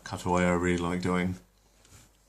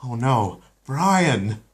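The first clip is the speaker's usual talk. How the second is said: higher, louder dawning blame; Lois realizing; 5 semitones higher, 6 dB louder, much slower than usual. Acted. Lois blames the family dog, higher and louder. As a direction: point the finger at the dog.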